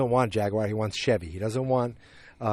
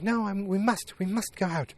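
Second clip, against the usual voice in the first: high put-on voice of an insistent wife; mock-wifely insistence in a high voice; wife's voice; 9 semitones higher than usual. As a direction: imitate a wife urging her husband, pitched up. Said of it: "And she's like".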